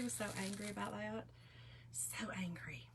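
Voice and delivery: hushed whisper so he won't hear. Real, not acted. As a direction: whisper it, keep it secret. Teasing about husband asleep nearby.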